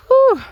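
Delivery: high, loud whew. Real, not acted. Gardener about to get busy on the tunnel, loud whew. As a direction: blow out a high, sharp whew.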